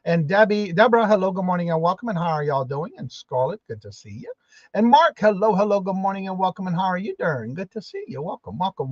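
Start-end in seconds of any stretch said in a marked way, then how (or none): none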